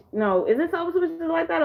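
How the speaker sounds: ordinary talk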